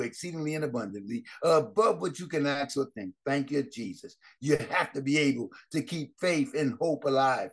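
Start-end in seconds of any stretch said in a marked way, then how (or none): none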